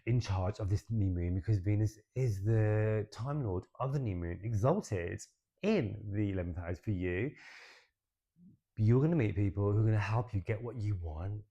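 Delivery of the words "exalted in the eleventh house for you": ordinary talk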